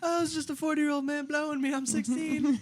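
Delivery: high joking mock. Teasing friend, high mock voice. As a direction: tease in high put-on voice.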